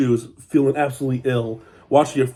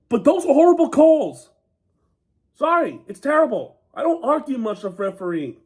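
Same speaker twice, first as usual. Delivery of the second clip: higher, heated rant at the refs; frustrated rant; 9 semitones higher than usual. Real, not acted. Rugby fan ranting to viewers about the referees, angry and exasperated. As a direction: vent hard about the terrible calls. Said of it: "I'm sorry for my rant".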